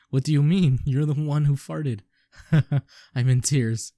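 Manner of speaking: real laughter, barely getting the words out; laughing hard. Real, not acted. Player losing it over the farting character, laughing loudly.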